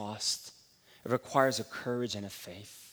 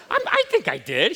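louder, higher, with a laugh; sheepish laughing dodge; 8 semitones higher, 10 dB louder than usual. Retold or imitated. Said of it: "I was like".

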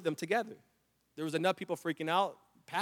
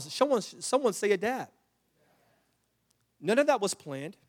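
higher, louder preacher's call; rising preaching; 7 semitones higher, 5 dB louder than usual. Acted. Pastor urging the church to adapt, higher and louder. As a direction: build it up for the congregation.

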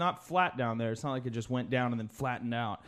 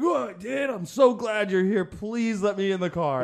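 pleading; 6 semitones higher, 4 dB louder than usual. Retold or imitated. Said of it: "And I'm like".

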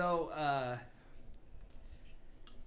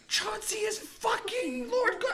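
high, laughing message yell; shouting; 8 dB louder than usual. Acted. Player messaging friends the big secret, shouting. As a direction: blurt the secret at a hammed-up shout. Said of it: "I'm assuming we're shouting at the message".

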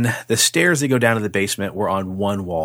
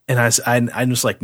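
ordinary delivery

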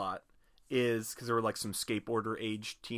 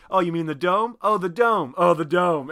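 loud, raised-pitch chorus of mock realization; townspeople exclaiming; 5 semitones higher, 11 dB louder than usual. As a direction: call it out emphatically, one townsperson after another. Acting townspeople all saying dome.

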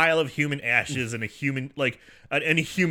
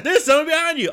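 put-on much higher, louder panicking boys; panicked kid voice; 14 semitones higher, 7 dB louder, faster than usual. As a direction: yelp it like frightened kids. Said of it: "the two butt boys are like".

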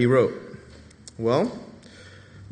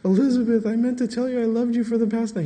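mock tender husband; 9 semitones higher, faster than usual. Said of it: "he doesn't say, like".

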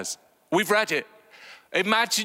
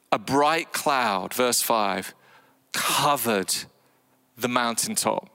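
slow reading; 5 semitones lower, slower than usual. Acted. Dramatic scripture reading.

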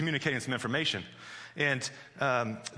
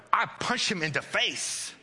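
higher, slow imitation of an angry child; worked-up toddler; 5 semitones higher, slower than usual. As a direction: punch out the child's threat slowly. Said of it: "the more worked up she got".

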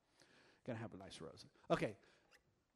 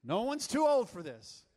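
ordinary delivery

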